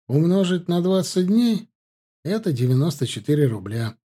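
plain reading voice

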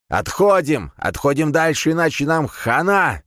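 urgent high yell to retreat; shouting. Acted. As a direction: shout the warning, loud and high. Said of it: "закричал старик".

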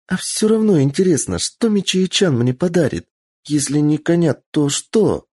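put-on high, excited child voice; bubbling with curiosity; eager little girl. Girl switches topic eagerly.

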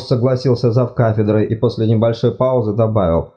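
plain reading voice

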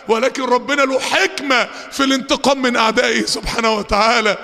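usual speaking voice

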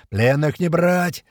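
understated drawn-out yell; yelled order. A narrator's reading. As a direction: stretch it out like a battlefield call. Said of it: "Это точно Котлет орёт".

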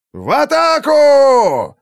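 very high, slow roaring shout; full battle cry. A narrator's reading. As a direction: scream the charge at full force. Commander ordering the charge.

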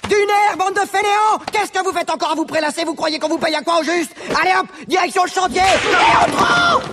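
high barking shouts at lazy workers; cruel taskmaster. Acted. Foreman yelling at lazy workers.

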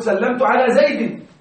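زيد pronounced "Zaydin" after the preposition; correct genitive ending. Shown as a demonstration. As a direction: end زيد on a clear "-in": Zaydin. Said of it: "حروف الجر تجر".

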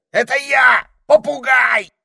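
loud high shout. A narrator's reading. Parrot shouting his name at the ermine. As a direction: bellow who you are. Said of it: "закричал попугай".

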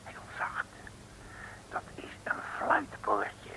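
softly whispered, almost no voice. Acted. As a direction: hush everything while picking the lock. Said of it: "Moet je zachtjes praten".

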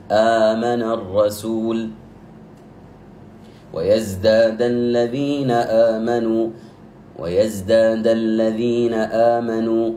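آمن with its opening long a drawn out for two beats; slow recitation. Shown as a demonstration. Teacher giving the badal example, slow recitation. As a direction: chant آمن الرسول slowly, holding the long a. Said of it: "جاءتني همزة".

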